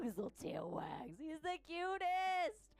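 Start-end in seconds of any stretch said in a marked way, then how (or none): 1.2-2.7 gushing. Real, not acted. Gushing over balloon shark.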